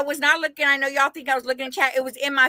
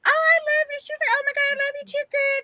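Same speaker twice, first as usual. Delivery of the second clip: high-pitched mock gushing woman; gushing admirer; 13 semitones higher, faster than usual. Woman fawning over the man who likes her accent, high mock gushing. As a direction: imitate her in a high, excited squeal. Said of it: "'cause she had an accent".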